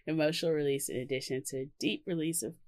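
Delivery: hiccuping while reading; reading through hiccups. Real, not acted. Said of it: "Sorry, I have the hiccups now".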